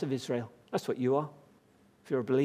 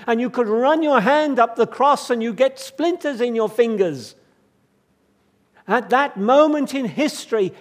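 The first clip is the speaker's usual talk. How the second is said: higher, louder impassioned preaching; fervent preaching; 5 semitones higher, 8 dB louder than usual. Real, not acted. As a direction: proclaim it with rising fervour to the congregation. Preaching at full force.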